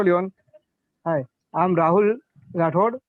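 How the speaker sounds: ordinary talk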